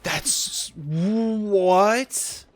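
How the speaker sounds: ordinary talk, nothing marked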